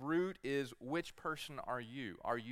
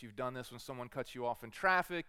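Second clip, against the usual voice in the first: normal talking voice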